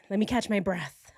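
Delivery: ordinary talk, nothing marked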